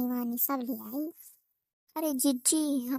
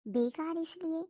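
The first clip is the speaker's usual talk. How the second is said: soft, even storyteller voice; gentle comic narration, quieter; story narration; 5 dB quieter than usual. A narrator's reading. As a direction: tell it like a village tale to listeners. Narrates the village tale.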